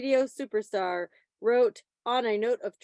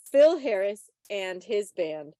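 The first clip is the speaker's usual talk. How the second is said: ordinary talk, nothing marked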